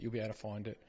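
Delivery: ordinary talk, nothing marked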